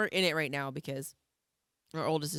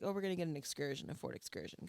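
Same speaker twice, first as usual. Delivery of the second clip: quiet teasing; 10 dB quieter, faster than usual. Retold or imitated. Parents teasing son quietly.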